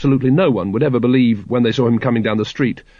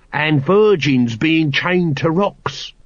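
slow, higher-pitched know-all Nobby figure; 5 semitones higher, much slower than usual. A narrator's reading. Nobby adding to the sergeant's dragon lore, knowing. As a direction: draw it out knowingly in a put-on voice.

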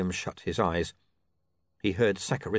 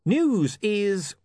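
William's voice; 11 semitones higher, 3 dB louder than usual. Acted. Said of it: "William began".

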